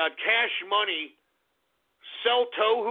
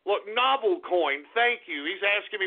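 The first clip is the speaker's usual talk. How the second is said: usual speaking voice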